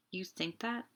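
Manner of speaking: a stunned whisper. A narrator's reading. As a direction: breathe it in disbelief. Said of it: "she whispered".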